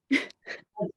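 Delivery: a teary half-laugh, half-sob; a real laughing cry breaking through; breathless teary laugh; tearful laugh-cry. Real, not acted. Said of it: "look at me, I'm still emotional".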